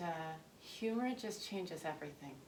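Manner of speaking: normal talking voice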